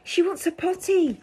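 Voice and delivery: high, loud alarmed cry; king crying out. Acted. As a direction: shout it up the castle. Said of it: "cried the king".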